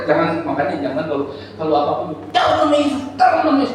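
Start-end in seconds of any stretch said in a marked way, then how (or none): 2.3-3.7 mock high-pitched frantic invocation; hammed-up urgent cry; shouting pastor. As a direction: imitate a pastor yelling a prayer. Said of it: "banyak pendeta begitu kan".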